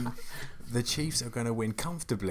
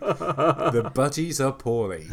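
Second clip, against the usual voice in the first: high, slow, laughing silly line; laughing parody; 5 semitones higher, 8 dB louder, much slower than usual. Pretend. Joking about sick budgies, laughing. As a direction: spoof the catchphrase while cracking up.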